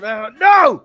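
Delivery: loud sharp no; loud protesting shout; retold scream. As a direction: yell it across the bar. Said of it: "I scream across him".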